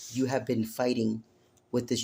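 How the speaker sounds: normal talking voice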